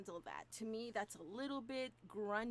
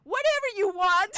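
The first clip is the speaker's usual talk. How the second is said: mock exclaim; 7 semitones higher, 18 dB louder than usual. Translating mom's line, mocking.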